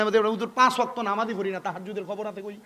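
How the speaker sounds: hushed, conversational mimicry fading out; mock congregant's excuse. Voices a reluctant congregant's excuse.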